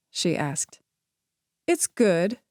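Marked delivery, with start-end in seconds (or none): 1.7-2.4 light. A narrator's reading. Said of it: "he said lightly".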